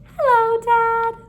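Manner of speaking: put-on baby creature greeting the duck as its dad; cute baby creature. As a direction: greet him like a happy baby monster. The creature speaks.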